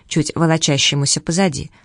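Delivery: ordinary delivery, nothing marked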